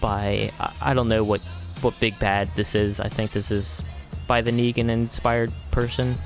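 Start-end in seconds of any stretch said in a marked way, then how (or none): none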